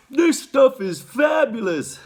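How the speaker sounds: put-on high, slow, gushing boy; loud and high, savor every word; greedy boy in raptures; Augustus. A narrator's reading. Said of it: "said Augustus".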